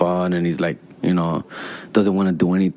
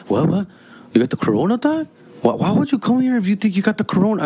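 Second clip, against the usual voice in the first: high, fast alarmed yelp; alarmed shock; 12 semitones higher, faster than usual. Retold or imitated. Narrator freaks out at his brother-in-law, high and alarmed. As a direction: jump back in panic at the word corona. Narrator panics about corona.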